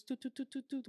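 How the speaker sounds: high, soft little toots; imitated sound effect. Shown as a demonstration. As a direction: mimic a selection jingle with quiet, high toots. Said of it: "can we have the sound effect".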